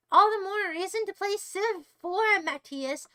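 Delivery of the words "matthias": ordinary delivery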